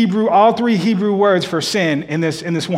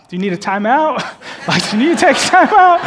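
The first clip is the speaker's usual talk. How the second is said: wife's amused question, laughing, rising high; wife teasing; 7 semitones higher, 4 dB louder than usual. Retold or imitated. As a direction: tease him gently through a laugh. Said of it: "my wife looked at me".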